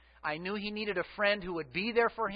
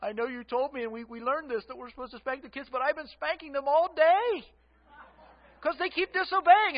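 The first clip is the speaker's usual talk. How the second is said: rising higher and louder as the frustration spills out; a distressed wife building from pleading to near-wailing; upset wife, close to tears; 6 semitones higher, 4 dB louder than usual. Retold or imitated. Wife on the phone to husband at work, half crying. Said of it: "she's kind of crying".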